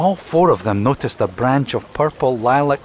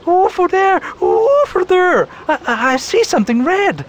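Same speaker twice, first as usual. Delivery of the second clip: very high, loud excited calling; excited robin; 5 dB louder than usual. Acted. Robin spotting something red in the reeds, excited calling. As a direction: point and cry out in a rush. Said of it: "Rose began to whistle excitedly".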